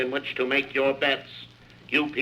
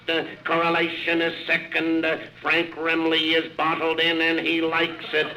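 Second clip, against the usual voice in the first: brisk racetrack announcer play-by-play; race call; 4 semitones higher than usual. Acted. Announcer calls the race to the crowd, live play-by-play.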